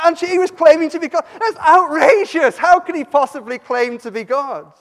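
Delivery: acted indignation, loud and high; outraged objection. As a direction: exclaim it like scandalised intellectuals. Acting out the outraged scholars' objection.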